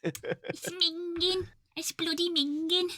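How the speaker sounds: high, drawn-out mock British accent. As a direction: squeak out British slang in a put-on accent. Joking imitation of British slang.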